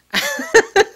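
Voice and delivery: loud, high burst of real laughter; a genuine burst of laughter. Real, not acted. Laughing about kilt idea.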